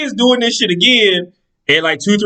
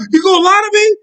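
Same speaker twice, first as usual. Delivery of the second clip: mock furious boyfriend pressing her; imitating the angry boyfriend. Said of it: "When he start doing that".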